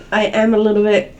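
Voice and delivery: normal talking voice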